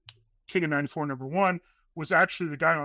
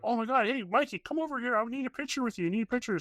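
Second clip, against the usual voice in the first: imitating excited fans, higher; mock-gushing fan calling him over, pitched up; eager fans begging for a picture, higher voice; 7 semitones higher, faster than usual. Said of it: "they're like".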